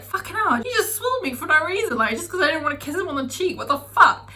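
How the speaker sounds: reliving the fury at the guy who threw the beer; retold angry rant. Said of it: "I saw red".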